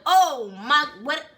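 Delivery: loud, high alarmed reaction; shocked disbelief. Retold or imitated. New recruit hearing she joined hell, loud shocked disbelief.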